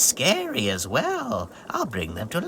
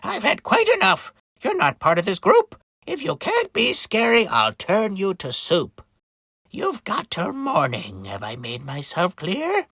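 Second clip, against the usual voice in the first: threatening witch; 6 semitones higher than usual. Acted. Witch threatening to turn Christopher into soup, stern. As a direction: lay down an ultimatum as a witch.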